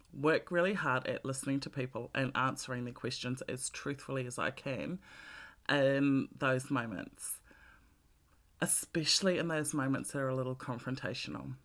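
normal talking voice

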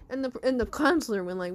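plain reading voice

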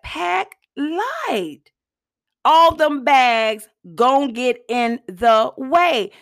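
slow, stretched-out delivery; drawn-out quote. Retold or imitated. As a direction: draw out each word of the saying. Quoting Erica's advice, drawn out.

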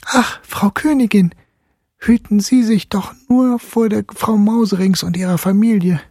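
put-on high, anxious girl's voice; trembling girl. A narrator's reading. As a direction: warn the queen earnestly. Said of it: "zitterte und bebte Marie und sprach".

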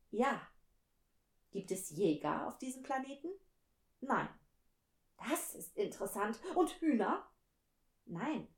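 expressive storybook reading with lively dialogue; animated read-aloud with unhurried pauses; story narration. A narrator's reading. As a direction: read the tale to listeners, letting the pitch swing on quoted speech.

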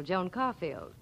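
ordinary talk, nothing marked

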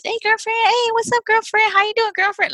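put-on high-pitched chummy girlfriend greeting; mock mom-to-her-girlfriends voice; imitating mom's friends. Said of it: "my mom would always do that".

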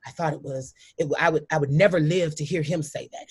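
usual speaking voice

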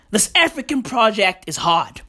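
ordinary delivery

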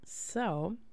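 normal talking voice

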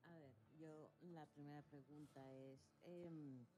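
barely audible, far from the mic; very faint audio. Real, not acted. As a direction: voice coming through very faintly. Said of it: "Te escucho muy bajito".

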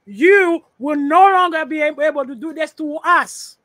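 loud, pounding declaration; forceful shout. Real, not acted. Speaker declaring to the West, loud and forceful. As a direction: hammer the words home at full volume. Repeats his point, rising to a climax.

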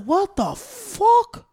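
drawn-out disbelief. Retold or imitated. Reacting to the bitten man's fuss, slow stunned disbelief. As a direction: stretch it out in disbelief.

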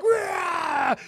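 put-on high, loud playful toddler roar; kid's roar. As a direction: roar like the screaming kid running circles. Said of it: "he runs and he's like".

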